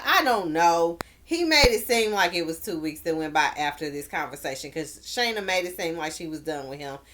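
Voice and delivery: normal talking voice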